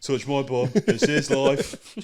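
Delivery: put-on loud, drawn-out Eastern European accent. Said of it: "weird Eastern European accents".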